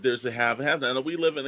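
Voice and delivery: ordinary talk, nothing marked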